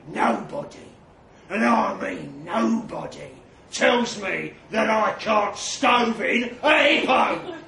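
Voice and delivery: gravelly threat building to a shout. A narrator's reading. Trev refusing to back down over hippos, gravelly. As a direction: start in a rasp, build to loud slow roar. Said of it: "his voice like gravel".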